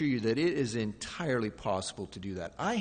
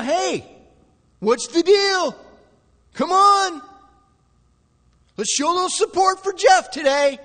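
high, loud indignant shout; blaming; 13 dB louder than usual. Acted. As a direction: shout an accusing demand for support. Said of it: "You want to blame him for it".

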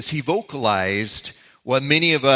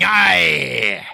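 a hint of a gruff pirate; 7 dB louder than usual. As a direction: growl it out like a playful pirate. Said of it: "talk and act like a pirate".

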